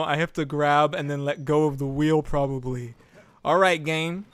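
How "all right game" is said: usual speaking voice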